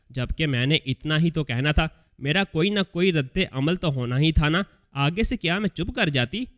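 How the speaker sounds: ordinary delivery, nothing marked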